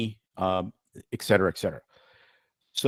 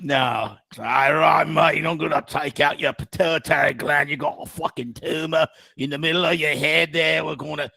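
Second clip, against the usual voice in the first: loud, higher-pitched broad Aussie; hammed-up Australian like a drunk Southerner; Australian accent; 4 semitones higher, 5 dB louder than usual. Aussie surgeon explaining pituitary surgery to patient, broad Australian accent. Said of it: "then you have the Australian accent".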